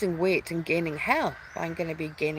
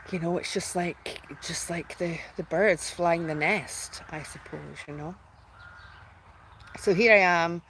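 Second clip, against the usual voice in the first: ordinary talk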